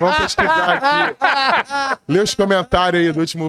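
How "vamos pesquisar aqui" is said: laughing. Real, not acted. Joking, laughing.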